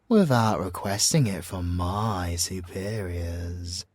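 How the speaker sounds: normal talking voice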